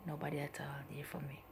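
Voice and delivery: fading down to a whisper; sad whisper. Real, not acted. Young mother alone in the shelter, sad whisper. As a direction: let the words drop away to a whisper. Alone and sick after birth.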